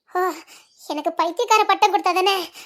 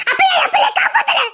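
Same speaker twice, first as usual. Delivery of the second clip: anguished scream; 9 semitones higher than usual. Acted. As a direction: scream the question at him. Repeat screamed about lost child. (இழந்த பிள்ளைக்காக கதறும் அலறல்)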